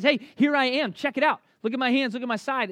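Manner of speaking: eager announcement. Retold or imitated. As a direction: show yourself off eagerly. Jesus acted, eagerly showing himself.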